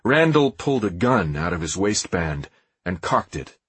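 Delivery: usual speaking voice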